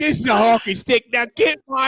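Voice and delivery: high, shouted mock Australian; hammed-up Aussie tough-guy accent. Host parodying a movie line about hockey, Australian accent. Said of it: "it's Australian".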